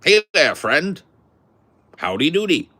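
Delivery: high, loud mock greeting; dudes talking like that. Imitating dudes who walked around greeting folks, high loud put-on voice. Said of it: "talking like that".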